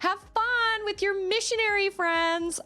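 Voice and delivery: high, over-sweet sarcasm; mock sarcastic gushing. Sarcastic comeback to a church classmate, mock gushing.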